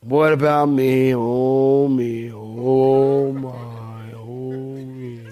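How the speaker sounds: low, slow, fading Eeyore moan; mopey Eeyore. Retold or imitated. Preacher mocks self-pitying Christians with a mopey moan. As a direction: sigh it out like a gloomy donkey. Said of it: "You're a spiritual Eeyore".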